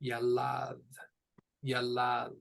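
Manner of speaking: yalad, "ya" then "lad"; Hebrew pronunciation. Shown as a demonstration. Teacher pronouncing yalad for the student, careful. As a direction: say yalad slowly, both syllables clear. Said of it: "It is pronounced".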